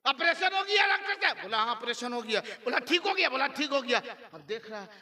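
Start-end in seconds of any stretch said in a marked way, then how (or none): 0.1-1.4 high-pitched, imitated astonished asking; acted disbelieving question. Astonished father questions the doctor. 2.8-3.3 high-pitched acted question on ठीक हो गया; imitated surprised relative; astonished question. Relative questions the doctor again.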